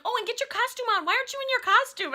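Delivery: high, fast, bossy mimicry; retold urgent ordering. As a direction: imitate someone hurrying a kid along. Retells friend urging the boy.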